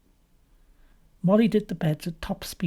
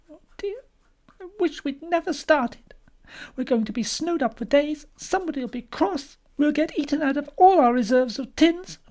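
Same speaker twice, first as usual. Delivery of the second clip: high, plaintive wife; despondent Molly; 12 semitones higher than usual. A narrator's reading. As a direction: lament the whole venture to your husband.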